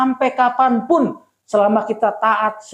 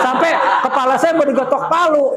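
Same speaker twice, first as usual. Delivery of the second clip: laughing through the words, a bit higher; 5 semitones higher, faster than usual. Real, not acted. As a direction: chuckle as you tell it. Laughing while retelling a hammer threat. (sambil tertawa)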